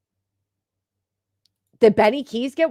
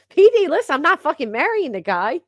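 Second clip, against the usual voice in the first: much higher, louder laughing denial; laughing protest; 8 semitones higher, 4 dB louder than usual. Real, not acted. Laughing at a chatter, denying she'll marry him, loud and high.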